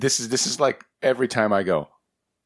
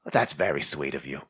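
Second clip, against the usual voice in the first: put-on soft old man voice; 8 semitones lower, 6 dB quieter than usual. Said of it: "And he's just like".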